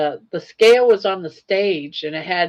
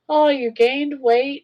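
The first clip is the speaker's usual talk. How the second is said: mock pitying host; 8 semitones higher, 3 dB louder, slower than usual. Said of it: "they go".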